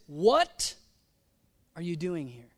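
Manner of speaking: WHAT loud and rising, then a pause; incredulous WHAT, set apart; stress on WHAT. Shown as a demonstration. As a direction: blurt a questioning WHAT, then drop for the rest. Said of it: "I'm imagining God's voice inflection".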